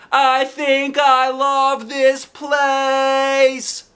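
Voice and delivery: very high, drawn-out gush of joy; excited. A narrator's reading. Junie B gushing about the library, excited. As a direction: stretch every word out in delight. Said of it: "feeling very excited".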